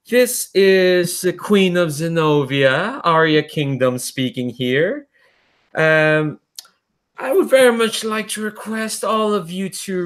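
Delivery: high, slow, sugary-sweet royal threat; mocking queen's broadcast. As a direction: taunt them through the speaker like a smug queen. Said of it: "it's a familiar voice".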